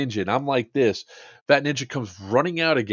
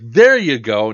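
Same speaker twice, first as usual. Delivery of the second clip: louder, cheeky call; mock triumphant; 11 dB louder, slower than usual. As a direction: announce it loudly and wryly. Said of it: "I was like".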